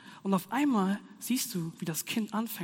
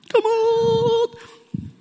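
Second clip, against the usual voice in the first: high, loud burst to pull himself together; self-exhortation; 11 dB louder than usual. Real, not acted. Preacher shouting at himself to stop laughing, loud and high. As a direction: snap yourself out of it.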